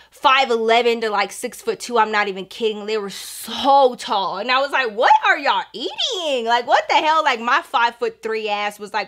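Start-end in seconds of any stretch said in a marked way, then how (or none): 4.9-7.2 comic incredulous cry; incredulous outburst. Retold or imitated. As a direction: exclaim it as if you can't believe your eyes. Teller acts out her astonishment.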